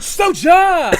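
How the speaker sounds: loud high contestant call; game show host. Pretend. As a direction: boom his name like a host. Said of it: "like a game show host".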